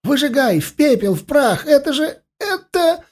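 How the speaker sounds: very high hysterical cries; choking frantic scream. A narrator's reading. Elf screaming in loathing to burn it, choking frantic cry. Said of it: "захлебнулся с собственным криком".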